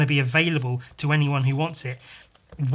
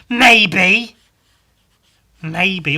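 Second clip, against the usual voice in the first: shouted admission; 4 semitones higher, 11 dB louder than usual. Real, not acted. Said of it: "Am I bitter".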